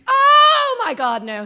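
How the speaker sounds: high, loud panicked cry of dismay. Retold or imitated. Late for class, car won't start, panicked cry. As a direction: wail it like the car just died. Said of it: "And I'm like".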